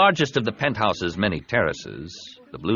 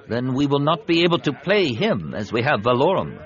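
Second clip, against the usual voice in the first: higher-pitched senator raising a doubt; doubtful alien senator, pitched up; dubious Quarren senator; 6 semitones higher, 3 dB louder than usual. Acted. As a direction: object in a high, altered character voice.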